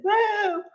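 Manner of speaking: high-pitched "woo" of approval; admiring whoop. Real, not acted. Host reacts to Matt Atkinson coming in, whooping admiringly. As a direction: whoop at a favorite actor.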